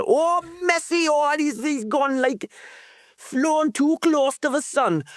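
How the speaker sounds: high, slow Geordie commentator voice, pitch way up; Geordie commentator impression. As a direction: ham up a Geordie football commentator. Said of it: "was that Geordie or Jamaican?".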